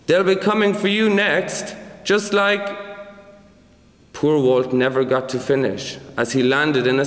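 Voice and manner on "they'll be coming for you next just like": high-pitched, mildly shouted warning. A narrator's reading. Walt warning the others as he sails away, shouted. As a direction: call it out higher without much added volume. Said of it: "Walt shouted".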